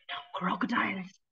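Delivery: breathy whispered aside. A narrator's reading. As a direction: whisper it like a secret. Narrator whispers about crocodiles.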